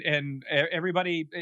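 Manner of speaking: ordinary talk, nothing marked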